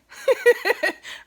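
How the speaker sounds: a loud high giggle; laughing at not knowing what to say. Real, not acted. Laughs while signing off.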